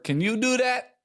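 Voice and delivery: high, mimicked challenge; imitating Italian customer. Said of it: "how many times has she heard".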